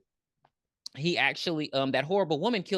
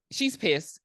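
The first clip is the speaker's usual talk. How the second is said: ordinary delivery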